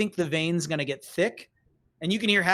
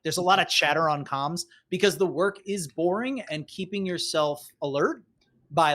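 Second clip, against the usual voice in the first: ordinary talk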